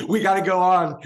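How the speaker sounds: loud, high-pitched cry through laughter; laughing mock urgency. Joking that the band is due on stage, laughing mock urgency.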